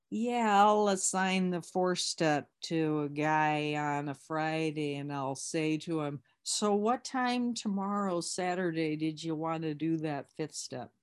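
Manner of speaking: drawn-out impression of a slow talker; slow, almost drunk. Imitating the circuit speaker assigning a four step, slow drawl. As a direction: stretch every word as if slightly drunk. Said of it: "he talks really slow".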